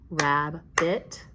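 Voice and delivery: "rabbit" in two distinct beats; clapped-out syllables. Shown as a demonstration. Teacher clapping out "rabbit" with pupils, syllable by syllable. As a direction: punch out rab, then bit in "rabbit". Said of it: "tap and clap again as we say".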